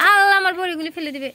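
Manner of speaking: high loud alarmed cry; alarmed exclamation. Real, not acted. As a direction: react to something about to spill. Cat tipping over drying lentils.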